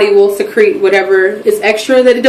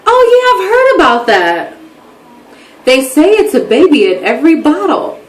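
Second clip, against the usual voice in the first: high-pitched, breezy recognition; lightly mimicked friend, lilting and high; imitated best friend; 6 semitones higher than usual. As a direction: pitch it up as the friend who already knows. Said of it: "She's like".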